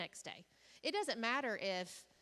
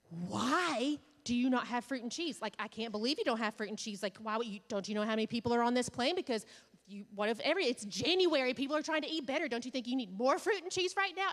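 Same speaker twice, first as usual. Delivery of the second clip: louder, rising rant at the stewardess; retold angry yelling; 2 semitones higher, 6 dB louder than usual. To a flight attendant, a mock-furious rant about fruit and cheese. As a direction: rant over the missing snack, half-mock. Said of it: "started yelling".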